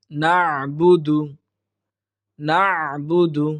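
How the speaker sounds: "না'বুদু" slow, squeezed throat sound after "na"; correct throat ayn. Shown as a demonstration. Teacher modelling the throat letter, careful tajweed. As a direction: hold the constricted ayn in "না'বুদু". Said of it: "উচ্চারণটা হবে".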